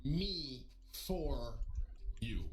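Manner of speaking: halting, drawn-out retold explanation; slow explaining. Lookalike stranger explaining himself to streamer, slow and deliberate. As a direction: spell it out slowly with long pauses. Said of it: "Let me explain".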